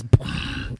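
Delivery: low, booming authority voice; low, weighty boom; authority figure. Acted. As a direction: drop a deep boom like someone in command. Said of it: "you hear a voice that goes".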